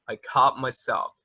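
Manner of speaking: slow model phrase. Shown as a demonstration. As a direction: slowly model "I caught myself".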